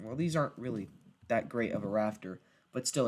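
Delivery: ordinary delivery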